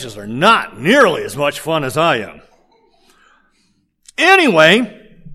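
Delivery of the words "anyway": higher, louder, drawn-out "Anyway"; a slow booming "Anyway"; loud reset. Real, not acted. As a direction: haul the room back to the story. Preacher pulling back on track.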